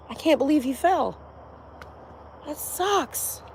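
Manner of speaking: high, soft mock innocence; feigned dismay. Imagined wife after shoving him off the balcony, fake dismay.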